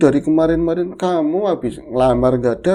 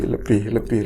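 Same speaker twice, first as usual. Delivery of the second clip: ordinary talk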